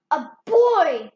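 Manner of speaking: loud shout; cried. Acted. Giant spotting Jack, loud shout. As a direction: bellow on seeing him. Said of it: "cried the giant".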